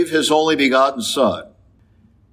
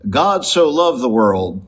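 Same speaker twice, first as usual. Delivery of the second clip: normal talking voice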